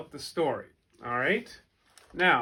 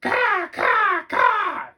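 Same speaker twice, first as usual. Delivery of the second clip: shrill loud caw, much higher; raven cawing; 12 dB louder, much slower than usual. Acted. Angry raven yelling down from the oak tree. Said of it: "yelled the second raven".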